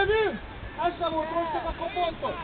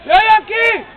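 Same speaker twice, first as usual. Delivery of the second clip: louder, urgent sideline calls; loud calling out; 7 dB louder than usual. Real, not acted. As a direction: keep pushing the player on. Coach calling out players.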